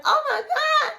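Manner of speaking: put-on thrilled girlfriend urging him to keep singing; delighted. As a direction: gush with a laugh, begging him on. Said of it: "she was loving it".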